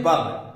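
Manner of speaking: बब said as a short clipped "bub"; pronouncing verb as बब. Shown as a demonstration. Teacher drilling class: वर्ब नहीं, बब. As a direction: say बब, not verb. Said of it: "इसको बब बोलते हैं".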